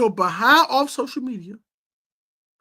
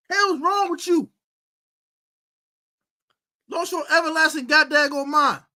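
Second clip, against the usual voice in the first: raised, shouted disbelief; angry rant; 5 semitones higher than usual. Real, not acted. Host ranting at a player who lost his mind online. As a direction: let the frustration boil over.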